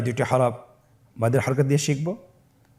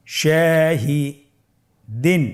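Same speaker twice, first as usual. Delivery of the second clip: Shahdeen with a long pause before the last syllable; very slow, split Shahdeen; broken into pieces; 2 semitones higher, much slower than usual. Shown as a demonstration. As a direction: say Shahi, stop, then add দিন. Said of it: "ভেঙে ভেঙে পড়ব".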